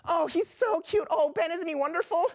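very high, squealing puppy adoration; gushing. Acted.

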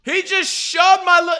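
loud, incredulous complaint; outraged protest. Real, not acted. Viewer disputing an out-of-bounds call, outraged protest.

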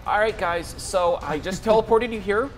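chirpy high voice of a nervous helper; Jeff the journeyman. Acted. GM voicing Jeff to the party, high and goofy. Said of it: "Jeff turns to you and says".

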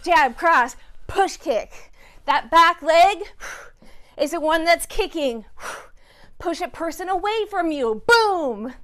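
loud, high coach calls; calling out. Real, not acted. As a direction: pump up the class.

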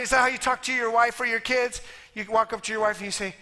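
usual speaking voice

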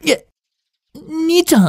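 high, surprised cry; startled squeak. Acted. Younger brother caught by the older one, startled. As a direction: jump at being caught.

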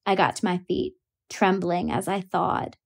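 plain reading voice

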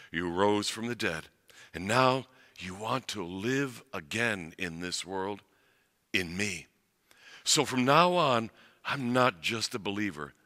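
pastor leading the congregation in prayer, hushed and earnest. Acted. Leads a prayer.

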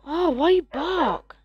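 high, slow, cooing; baby talk to dog. Acted. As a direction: coo at the dog. Talking to dog.

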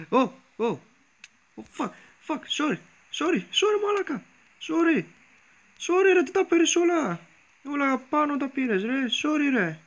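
frantic high pleading sorries; panicked apologies. Real, not acted. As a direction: beg forgiveness in a rush, voice jumping up. Panicked apologies after hitting a mob.

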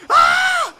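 very high, loud shriek of terror; terrified scream. Acted. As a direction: scream in pure horror.

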